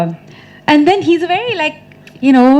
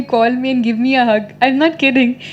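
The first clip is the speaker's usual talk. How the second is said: ordinary talk, nothing marked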